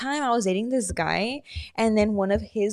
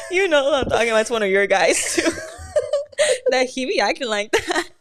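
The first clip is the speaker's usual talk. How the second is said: laughing; 4 semitones higher, 3 dB louder than usual. Real, not acted.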